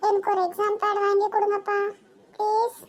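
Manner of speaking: put-on high, pleading child's voice, close to tears; whiny tearful little girl. Daughter pleading with father for an exam pad, high tearful whine. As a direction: beg dad for an exam pad like a crying kid.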